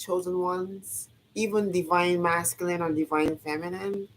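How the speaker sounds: usual speaking voice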